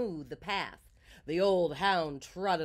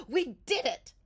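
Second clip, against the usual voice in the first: high, triumphant hen cheer; 8 semitones higher, 4 dB louder than usual. A narrator's reading. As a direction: cheer like hens celebrating a win. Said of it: "The hens cheered".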